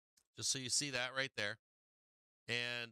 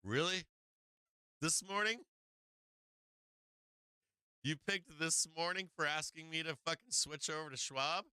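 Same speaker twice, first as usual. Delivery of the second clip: higher, rising disbelief; can't believe the timing; incredulous disbelief; 4 semitones higher than usual. Retold or imitated. Retelling his reply to the broker's switch request, incredulous and rising. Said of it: "And I was like".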